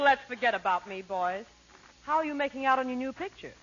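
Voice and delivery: ordinary talk, nothing marked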